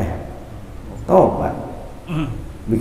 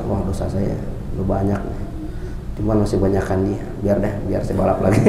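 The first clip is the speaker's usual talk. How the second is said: lower, softer mock inner voice of a shrugging sinner; hushed, flippant aside; careless sinner; 6 semitones lower, 7 dB quieter than usual. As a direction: mutter it like someone who doesn't care. Mocking a careless sinner's thoughts.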